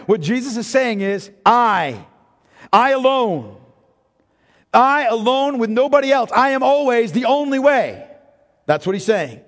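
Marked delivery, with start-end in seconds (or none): 1.4-8.1 "I" hit hard and loud each time, building; emphatic I. Shown as a demonstration. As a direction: press on "I alone" with weight. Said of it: "the Greek here is emphatic".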